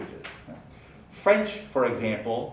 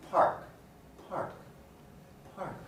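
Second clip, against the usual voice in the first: PARK fading down, P still puffed; getting quieter; 4 semitones higher, 10 dB quieter than usual. Shown as a demonstration. Teacher showing volume doesn't matter, quieter aspirated park. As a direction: say it softer each time, keeping the burst of air. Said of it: "even quietly".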